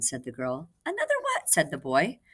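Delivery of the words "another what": very high puzzled question; high boy voice. Acted. Boy puppet asking the girl, very high. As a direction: pop it up like a curious boy.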